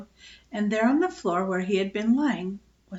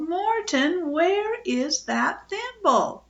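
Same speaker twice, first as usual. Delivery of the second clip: mother calling up the stairs, slow and high; a mother calling her son from downstairs; calling upstairs; 9 semitones higher, much slower than usual. A narrator's reading. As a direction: call him from the foot of the stairs. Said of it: "came to the foot of the stairs and called".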